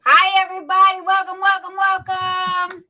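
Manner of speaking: high, loud hostess greeting; excited welcome. Real, not acted. Host to guests joining the show, high excited welcome. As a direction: pitch it up and gush the welcomes.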